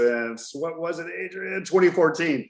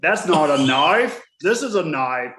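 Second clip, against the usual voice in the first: put-on Australian accent; 6 semitones higher, 5 dB louder than usual. Host showing off his only accent, mock Australian. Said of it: "that's the only accent I can do".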